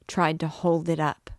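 usual speaking voice

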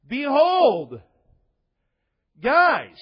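loud, high call for attention; calling out to disciples. Retold or imitated. Christ calling his disciples to attention, loud. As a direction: call the disciples to stop and listen. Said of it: "he looks at them and he says".

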